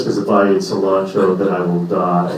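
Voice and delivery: low, slow doom voice; mock grave customer. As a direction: say it like a death sentence. Guy explains cilantro allergy.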